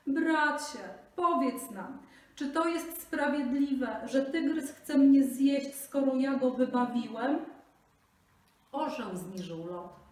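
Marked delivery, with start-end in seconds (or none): 0.1-7.4 high, loud imploring plea; begging Brahmin. Acted. Brahmin begs the soaring eagle, high and imploring. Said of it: "zwrócił się do niego błagalnie".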